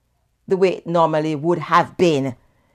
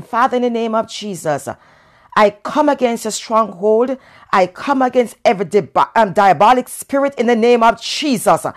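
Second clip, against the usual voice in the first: higher, faster fervent prayer; 6 semitones higher, 8 dB louder than usual. Acted. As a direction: pray hard against the stronghold.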